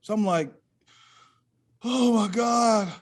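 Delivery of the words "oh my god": normal talking voice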